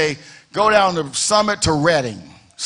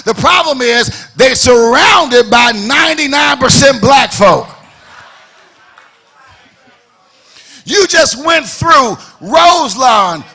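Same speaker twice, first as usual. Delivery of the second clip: louder and louder pulpit crescendo; building preaching; 3 semitones higher, 4 dB louder than usual. Real, not acted. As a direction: build the sermon to a shouting peak.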